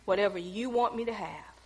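bow your head and pray quietly; humble prayer. Retold or imitated. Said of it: "I bowed my head".

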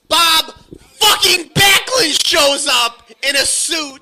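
high, loud, slowed outraged yell; exasperated shout. Real, not acted. Co-host fuming as Bob Backlund shows up, exasperated shout. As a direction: spit out the name in disbelief. Ranting about Backlund's appearance.

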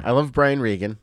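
ordinary talk, nothing marked

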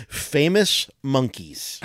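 very slow, drawn-out reveal; announcer voice. Pretend. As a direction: stretch the category out. Reads category card.

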